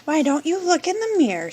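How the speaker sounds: a quick, high mother's suggestion; Mama Pig voice. Acted.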